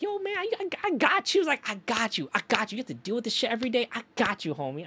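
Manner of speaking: high, buddy-buddy coworker voice; imitating the coworker's high, chummy voice; coworker imitation. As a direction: reassure your friend like a homie. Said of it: "She's like".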